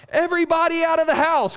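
high, loud alarmed yell. Retold or imitated. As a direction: shout it to get everyone out. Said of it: "I started yelling".

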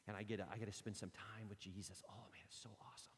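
soft, unvoiced whisper of delight; hushed, awestruck whisper; awed whisper. Real, not acted. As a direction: breathe it out like a secret joy. Hushed delight about Jesus.

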